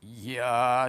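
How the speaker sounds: scornful jeering yah; sneering. A narrator's reading. Dwarfs sneering at the king. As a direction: spit it out with contempt. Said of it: "sneered the dwarfs".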